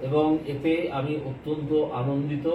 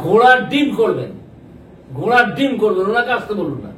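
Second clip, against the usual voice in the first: scornful loud retort; 2 semitones higher, 7 dB louder than usual. Real, not acted. Scornful retort to reporter's quote.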